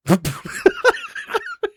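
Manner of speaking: very high-pitched burst of laughing; helpless laughter. Real, not acted. Storyteller after Curly's reply, helpless laughter. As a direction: crack up at the punchline.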